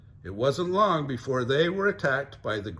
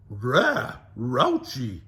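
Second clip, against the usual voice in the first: Scooby agreeing; 5 dB louder, much slower than usual. Acted. Scooby voiced.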